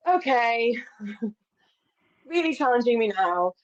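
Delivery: amused resignation with a chuckle; wry laughing. Retold or imitated. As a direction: laugh it off, exasperated. Ironic aside about her period.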